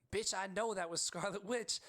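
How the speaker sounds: retelling an irritated comeback in the cinema; retold pissed snap. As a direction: snap it back at the girl, half-joking. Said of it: "I have never been so pissed in my life".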